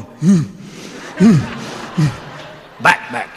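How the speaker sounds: dog growl. Retold or imitated.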